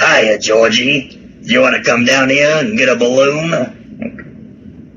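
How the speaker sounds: put-on slow, drawn-out Boston accent; mock-creepy Boston accent, luring a child; Tim Curry's Boston accent. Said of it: "scared of Tim Curry's Boston accent".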